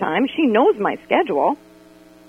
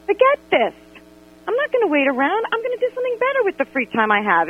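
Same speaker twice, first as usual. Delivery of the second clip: higher, exasperated "Forget this" and quicker brush-off; fed-up huff; 11 semitones higher than usual. Retold or imitated. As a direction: storm off in your head. Fed up leaving Panera.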